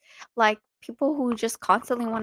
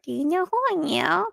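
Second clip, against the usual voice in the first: higher-pitched, mocking entitled voice; mock self-important; 7 semitones higher than usual. Imitating people in the wrong who use that card, mock self-important. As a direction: mimic someone pulling rank. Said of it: "they use that card".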